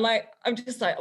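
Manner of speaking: ordinary talk, nothing marked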